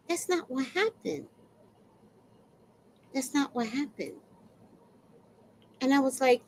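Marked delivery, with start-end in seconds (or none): 0.1-4.2 very soft, measured denial; restrained protest. Retold or imitated. Tenant answering the accusing HOA woman, quietly holding back. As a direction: say it small and careful, with pauses.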